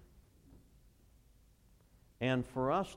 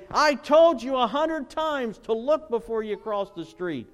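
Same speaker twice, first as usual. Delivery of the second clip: loud, high-pitched acted telling-off; parent scolding; 11 semitones higher, 12 dB louder than usual. Parent to child after running into the street, loud scolding. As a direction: scold at full voice like an alarmed parent. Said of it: "when you get there and you say".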